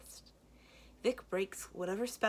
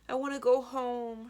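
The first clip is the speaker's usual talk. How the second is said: higher, louder tearful plea; crying; 8 semitones higher, 8 dB louder than usual. Acted. Rescued little sister crying to her brother. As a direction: sob it out clinging to your brother. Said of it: "she cries".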